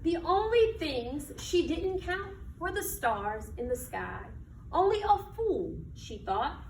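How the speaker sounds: slow, expressive picture-book reading; reading to children. Acted. Teacher reading a picture book to her class, storytelling voice. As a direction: read it like storytime on the rug.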